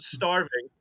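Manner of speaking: usual speaking voice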